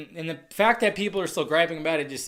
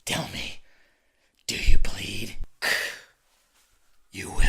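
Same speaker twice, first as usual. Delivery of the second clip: a hint of a low robotic Batman; low menacing quote, lightly voiced; Batman's robotic voice; 12 semitones lower than usual. Quoting the trailer's Batman, low menacing voice. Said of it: "you hear the voice".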